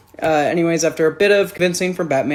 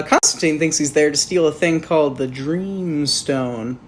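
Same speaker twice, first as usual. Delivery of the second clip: usual speaking voice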